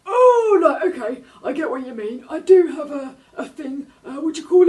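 high, loud, laughing cartoon shark; flustered shark. A narrator's reading. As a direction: stumble for the word with a nervous laugh. Shark fumbles for word.

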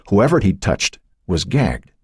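plain reading voice

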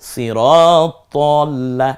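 very slow "সিরাত তল্লা" with long vowels; rounded "ত" recitation. Shown as a demonstration. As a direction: draw it out, heavy o in "তল্লা". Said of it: "সিরাত তল্লা বলবো". ("সিরাত তল্লা" টেনে, ভারী "ত" দিয়ে)

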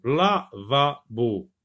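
Lavabo drawn out slowly, syllable by syllable; slow model word. Shown as a demonstration. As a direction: stretch out Lavabo for the class. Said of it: "teacher models the word". (Lavabo très lentement, syllabe par syllabe)

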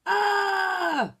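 high, loud startled ah; elephant's startled yelp; surprised cry. A narrator's reading. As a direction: jump in shock. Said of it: "cried Elephant in surprise".

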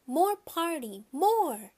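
put-on a toddler protesting leaving; little boy begging to stay at the party; crying out. A narrator's reading. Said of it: "He cried".

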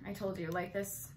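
ordinary delivery

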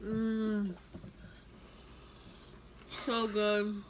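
chewing while talking; muffled through food; mouth full. Real, not acted. Seen 1: Bit pastry just before; chews, closed mouth, holding it throughout.

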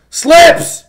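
loud, urgent shout of a name; calling out. Real, not acted. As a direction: yell for someone to come. Calling out again for help.

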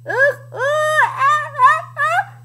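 high, loud "ah, ah" build-up; hammed-up pre-sneeze gasps; gasping before a sneeze. A narrator's reading. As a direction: gasp as a sneeze comes on. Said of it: "gasped the, the Tyrannosaurus".

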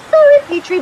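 loud, high, sarcastic sorry; mock apology. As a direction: toss it off dismissively. Brushing off Petrie.

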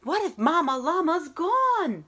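put-on a child suddenly scared in the dark; mock worried. Teacher voicing the baby llama's fear to her students, mock anxious.

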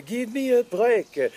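slow, drawn-out French jingle; slow French-accented jingle; mock French accent. Repeated jingle slower.

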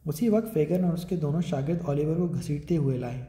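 usual speaking voice

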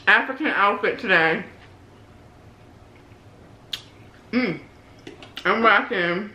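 chewing while talking; speaking between bites; mouth full. Real, not acted. Seen 0: Food goes in at -3; food visible in mouth while talking at +4.7.

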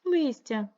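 Листя said high and strong; stressed answer word. Shown as a demonstration. Teacher models the answer, stressing Листя. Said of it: "Що тихо опадає на землю?".

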